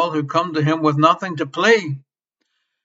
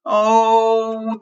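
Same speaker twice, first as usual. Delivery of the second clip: ordinary talk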